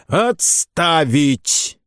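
drawn-out barked order; stern command. Acted. Captain silences the singing cadets, stern and drawn out. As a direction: halt the racket with one word.

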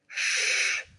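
sharp voiceless shushing hiss; hissed hush. A narrator's reading. Sally silencing David near the occupied room, hissed hush. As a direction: hush them urgently without any voice. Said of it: "прошипела Салли".

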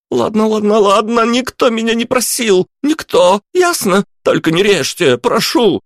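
very high, frantic babbling plea; squealing, desperate begging; panicked pleading. A narrator's reading. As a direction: tumble out the retraction in terror. Lad's finger seized, he panics.

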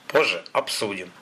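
understated hard-edged dismissal; harsh, firm. A narrator's reading. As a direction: shut the discussion down firmly. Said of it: "жёстко произнёс Михаил".

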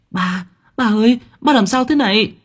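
high, panicked call to her father; crying out in alarm. Acted. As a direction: shriek for her dad in fear. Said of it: "kêu lên". (kêu lên hoảng hốt, giọng cao)

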